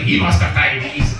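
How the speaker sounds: put-on slow, lightly demonic child voice; nephew's demon voice. Nephew concluding his uncle is ill, slow demon-like voice. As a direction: imitate a seven-year-old talking like a demon. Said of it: "that's the voice".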